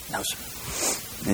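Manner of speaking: whispered, out of breath from the swim; breathless no. Acted. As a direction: gasp it out, spent. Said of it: "the guy's breathing hard".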